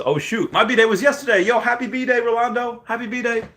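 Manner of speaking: squeaky put-on read of the message; high mock voice. Reading donor's message, high voice.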